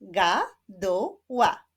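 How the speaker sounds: "Gaduła" in three slow, separate beats; split into syllables. Shown as a demonstration. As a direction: clap out "Gaduła" slowly, syllable by syllable. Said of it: "Zapraszam do wyklaskiwania sylab".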